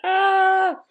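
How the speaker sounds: high-pitched yelp of hype; high excited squeal. Real, not acted. Fan squealing as the fight begins. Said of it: "I gotta stop screaming".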